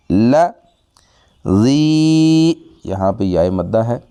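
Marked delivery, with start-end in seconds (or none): 1.5-2.5 زی drawn out one alif, high and sung; elongated zee. Shown as a demonstration. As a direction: stretch the vowel of زی. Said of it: "ایک الف کے برابر لمبا کر کے زال کو پڑھیں گے".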